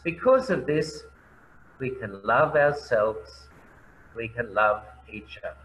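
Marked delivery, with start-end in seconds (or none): none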